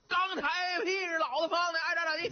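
high shouted boast, full of swagger; defiant roar at the card table. Acted. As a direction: bellow it out, defiant and cocky. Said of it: "吼道".

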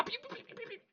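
very high squeaky pee-pee sliding noise through a laugh; sliding sound effect. Acted. Ghost sliding down oily mountain.

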